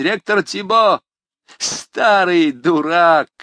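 high, loud jeering greetings; student jeering at the passing rector; mocking jeers. A narrator's reading. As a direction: heckle the rector with glee. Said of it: "воскликнул Жан Мельник".

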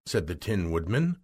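ordinary delivery, nothing marked

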